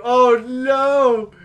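high, loud cry of alarm; dismayed yell. Real, not acted. Player sees ball heading in, dismayed shout.

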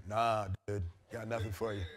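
lazy hippie voice. Retold or imitated.

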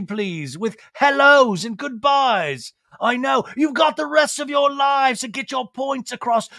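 high, theatrical pep talk; gushing British restaurateur impression. [name] coaching the guys by phone, theatrical impression. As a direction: sign off grandly in a high put-on voice.

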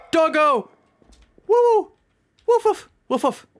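high, excited whoops and barks; delighted calling. Real, not acted. Player calling to his found dog, delighted. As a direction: call out to your dog with glee.

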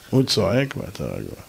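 very low, settled voice; calm composure. Shown as a demonstration. Preacher modelling composure against a shouter, calmly. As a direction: keep it low and completely unbothered.

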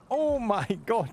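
high-pitched mimicry of a mock-Swedish voice; imitated Swedish accent. Friend demonstrating the voice being asked about, imitated Swedish accent. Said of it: "You know that voice that you put on".

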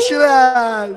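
high, loud burst of excitement; excited name call. Real, not acted. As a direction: shout the player's name on a big play. Big play in live match commentary.

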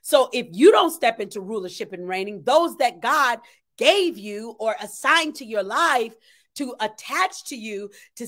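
normal talking voice

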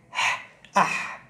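ح- breathed out without voice, then أح; breathy ha sound. Shown as a demonstration. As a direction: let ح- come out as a voiceless h. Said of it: "لكن نطقه ح".

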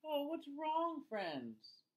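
usual speaking voice

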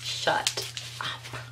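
almost no sound, pure awe; faint whisper. Real, not acted. Awed disbelief, even softer.

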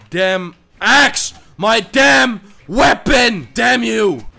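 slow, loud yelled demands; angry shout. Real, not acted. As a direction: shout in frustration at the opponent. Shouting at opponent in game.

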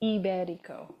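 IBERICO softly repeated as "ee-BEH-dee-koh"; corrected pronunciation. Shown as a demonstration. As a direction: say Iberico starting ee, with a tapped r. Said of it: "Now I'm remembering".

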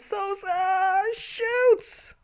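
very high, stretched commentator cry; excited commentator call. Pretend. Mock commentary on shot.

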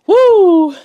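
very high, very loud woo; joyful whoop. Real, not acted. Cheering his food to the camera, a loud high whoop. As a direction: let out a shrill cheer of joy. Celebrating the meal.

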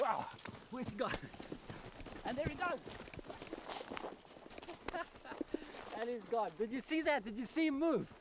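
high, thrilled exclamations with a breathy laugh; real excitement. Real, not acted. Explorer watching the freed lizard rocket away, excited. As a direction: marvel at how fast it vanished.

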